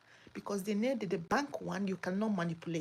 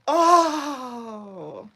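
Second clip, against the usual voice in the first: a sudden cry as if about to weep; near tears; 3 semitones higher, 11 dB louder than usual. Real, not acted. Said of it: "I feel like crying".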